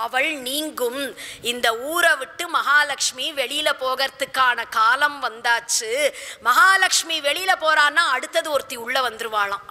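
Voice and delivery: ordinary talk, nothing marked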